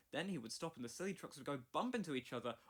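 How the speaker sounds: ordinary delivery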